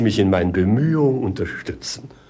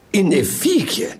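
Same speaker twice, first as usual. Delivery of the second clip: delighted question; 8 semitones higher than usual. Acted. King seizes on the idea.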